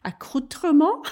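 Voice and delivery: accoutrement said the French way, drawn out; French accent. Shown as a demonstration. Host showing off the French accent on accoutrement. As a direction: roll out accoutrement with a French flourish. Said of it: "I want to say it in French accent".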